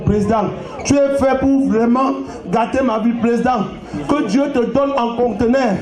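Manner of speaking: ordinary talk, nothing marked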